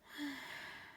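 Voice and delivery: soft sigh holding back tears; tearful sigh. Real, not acted. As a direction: let out a quiet, emotional sigh. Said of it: "Try not to cry".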